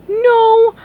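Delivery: high loud protest. Acted. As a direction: cry out against it. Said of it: "said Mindy".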